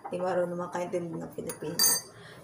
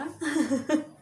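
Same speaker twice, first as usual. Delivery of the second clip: laugh; 8 semitones higher, 4 dB louder than usual. Real, not acted. Laughing at Anne's question.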